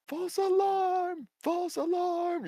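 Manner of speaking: very high, slow call; drawn-out high shout to the city; yelling false alarm. Acted. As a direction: friend calling off the alarm. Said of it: "your friend yell out".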